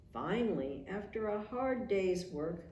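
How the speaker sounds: ordinary delivery, nothing marked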